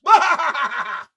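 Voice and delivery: loud mocking shout-laugh; gloating laugh. Real, not acted. Mocking laugh at the losing fans, loud gloating. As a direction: cackle in their face.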